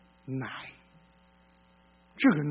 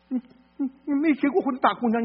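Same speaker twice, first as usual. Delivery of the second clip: higher, mocking rise on 过; 8 semitones higher than usual. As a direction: scoff at the unmarried girl, pitch up on 过. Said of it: "repeats phrase mocking the dean".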